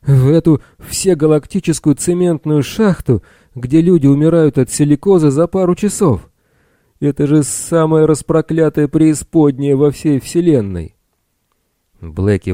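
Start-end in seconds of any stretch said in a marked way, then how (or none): 0.0-10.9 high, loud raging shout; hoarse yell. A narrator's reading. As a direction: keep roaring in outrage. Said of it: "хрипло заорал Билл".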